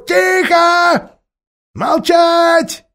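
high, loud barked order; barking ape. Acted. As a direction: snap them into silence. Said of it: "рявкнул обезьян".

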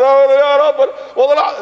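high, loud frantic begging; mock desperate plea to God; pleading damned man. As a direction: beg God in panic.

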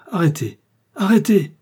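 loud, high cry to halt; urgent call. A narrator's reading. Raoul halting the men carrying the bandit, urgent shout. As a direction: call out sharply to stop them.

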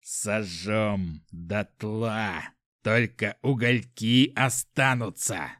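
very slow, menacing threat; low start, drawn-out seething menace; through gritted teeth. A narrator's reading. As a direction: squeeze each word out between clenched teeth. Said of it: "сквозь зубы цедя".

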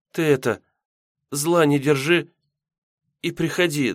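put-on high-pitched, hesitant girl's plea; tender apology. Yulka asks Misha back, tender high-pitched plea. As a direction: make up with him haltingly. Girl makes up with beloved.

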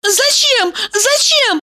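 high coy protest; protesting girly voice. Acted. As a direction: resist playfully and high. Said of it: "сопротивлялась она".

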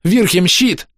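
high-pitched urgent command; panicked cry. Acted. Биара calls on the mage for a shield, panicked shout. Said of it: "в панике закричала Биара".

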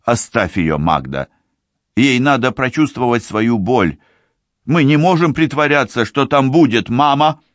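put-on high, firm young woman's voice; lightly voiced firm sister; man doing a woman's voice. A narrator's reading. Tsibi restrains Magda.

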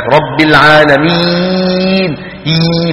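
long, tense "ee" squeezed in العالمين; over-pressed ya. Retold or imitated. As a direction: imitate a tense reciter pressing mid-tongue through العالمين. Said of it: "المبالغة في الضغط على وسط اللسان". (العالمين بياء مشدودة مبالغ فيها)

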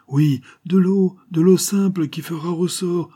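very high, exultant voice; excited revelation. A narrator's reading. Inventor reveals simple water as the spring, elated. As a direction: reveal the solution in elation.

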